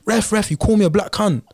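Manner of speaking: mock the London lad's accent as he appeals to the ref; London accent impression. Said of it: "In, in his accent".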